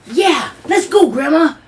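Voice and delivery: loud, high, slow excited shout; eager child shout. Retold or imitated. Granddaughter begging grandma for the mountains, loud slow excited cheer. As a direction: cheer like a thrilled little kid.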